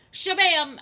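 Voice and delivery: Shabam belted out high and loud; loud punchline shout. Pretend. Retelling the joke to viewers, loud high shout. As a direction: slam it like a comic-book sound effect. Punchline sound effect repeated.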